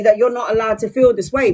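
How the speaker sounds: ordinary talk, nothing marked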